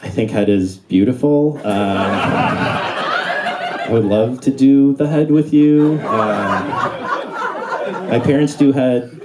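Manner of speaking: a hint of a fumbling kid voice; awkward kid. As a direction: play it as a clueless sixth grader. Kid's awkward reply retold.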